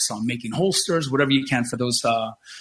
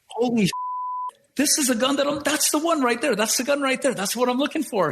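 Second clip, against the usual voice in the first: much higher, breathless excitement; blurting it out as he finally spots the gun; excited rush; 12 semitones higher than usual. Retold or imitated. Retelling finding the gun, high excited voice.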